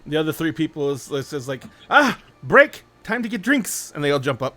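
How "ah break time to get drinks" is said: loud, high, jubilant shout; acted burst of glee, pitched way up; excited call-out. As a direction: call a drinks break to the whole table. Table calls out a break.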